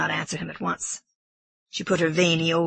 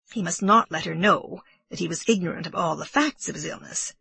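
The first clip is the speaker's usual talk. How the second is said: plain reading voice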